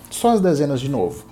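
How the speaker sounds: ordinary talk, nothing marked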